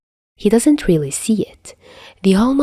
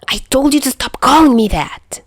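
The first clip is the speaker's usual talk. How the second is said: screech; 7 semitones higher than usual. Acted. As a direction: shriek it in outrage. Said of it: "Juya screeches".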